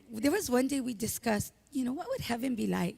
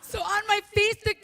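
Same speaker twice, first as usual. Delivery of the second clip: choked-up real sobbing through the words; grieving friend cries out; crying; 10 semitones higher, 6 dB louder than usual. Real, not acted. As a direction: voice breaking into tears, high and loud. Breaks down remembering friend.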